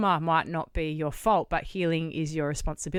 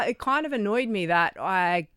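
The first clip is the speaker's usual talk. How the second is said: normal talking voice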